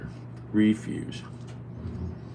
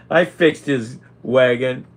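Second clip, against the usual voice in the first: loud, slow, higher gloat; 5 semitones higher, 8 dB louder, slower than usual. Real, not acted. Gloating to the camera about the cat, loud and slow. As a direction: savour the little victory. Gloating after mustard prank.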